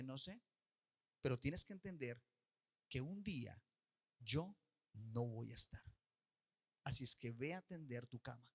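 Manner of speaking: a mother holding the line gently; quiet firm mother. Retold or imitated. Mamá responde con firmeza. (mamá a la hija, firme y suave)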